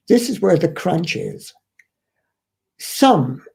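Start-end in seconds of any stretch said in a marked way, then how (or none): 2.8-3.4 "Some" said alone, low and falling, then a pause; stress on some. Shown as a demonstration. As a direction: lean hard on "Some" before moving on. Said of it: "I emphasize the word some".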